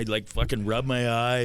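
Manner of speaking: normal talking voice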